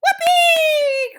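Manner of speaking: high, long gleeful whoop; cried out. A narrator's reading. Witches clapping their hands, cried out. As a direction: whoop with delight. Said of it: "cried the witches".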